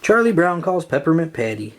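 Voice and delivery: usual speaking voice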